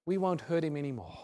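ordinary delivery

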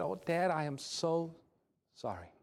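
retold remorse, sinking to very soft; imitating a weeping child's apology, understated; tearful apology. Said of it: "I began to weep".

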